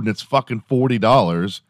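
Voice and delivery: ordinary talk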